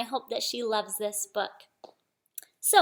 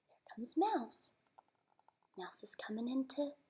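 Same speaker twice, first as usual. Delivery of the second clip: hushed storytelling whisper; 15 dB quieter than usual. A narrator's reading. Reading to a child, hushed whisper. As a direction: whisper it so the bear stays asleep. Whispered read-aloud suspense.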